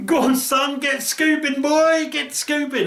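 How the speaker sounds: high, excited urging; cheery high-pitched encouragement; egging him on. Retold or imitated. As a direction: hype him up to scoop the fish. Urging mate to net fish.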